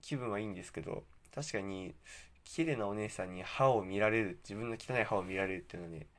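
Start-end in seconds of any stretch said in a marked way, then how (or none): none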